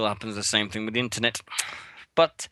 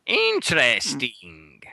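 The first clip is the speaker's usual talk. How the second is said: very slow, drawn out; much slower than usual. Real, not acted. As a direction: stretch the word out slowly. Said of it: "that's interesting".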